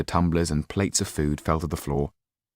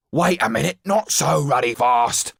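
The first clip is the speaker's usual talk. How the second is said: high, loud shout after a carriage; angry shout; 10 semitones higher, 4 dB louder than usual. A narrator's reading. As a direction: yell at the departing wheels. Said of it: "shouted Mister Tull".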